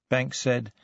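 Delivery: ordinary delivery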